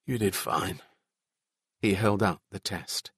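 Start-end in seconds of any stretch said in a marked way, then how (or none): 0.0-0.8 hoarse voice. A narrator's reading. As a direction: say it softly, slightly hoarse. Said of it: "said in a hoarse voice".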